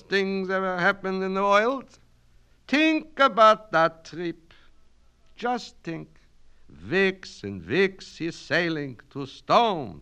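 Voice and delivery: put-on high, loud Yiddish-accented orator; Yiddish-accented Kaplan, holding forth grandly; Yiddish-accented immigrant student. Student lecturing his night class in a Yiddish accent.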